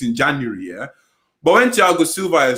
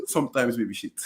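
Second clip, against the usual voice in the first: soft, low aside through laughter; very quiet; 4 semitones lower, 7 dB quieter than usual. Real, not acted. Co-host sneaks in a jibe about a player, very quietly. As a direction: slip the jibe in under the breath. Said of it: "he said that very quietly".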